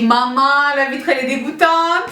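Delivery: loud, high dismayed cry; dismayed exclamation. Retold or imitated. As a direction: exclaim in disgust at the window. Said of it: "je me dis". (cri dégoûté)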